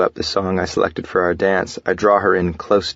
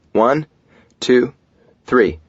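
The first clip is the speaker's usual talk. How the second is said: raised, punchy count with pauses; 6 semitones higher, 5 dB louder, much slower than usual. A narrator's reading. Husband counting before the coin toss, loud countdown. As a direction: count it off loudly, one word at a time. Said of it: "holding my fingers up, I say".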